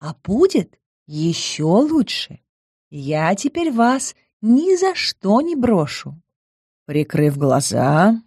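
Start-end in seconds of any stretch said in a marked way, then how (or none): 0.0-6.2 slow, high and tender; warm. A narrator's reading. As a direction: promise it sweetly and slowly. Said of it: "улыбнулась с такой теплотой".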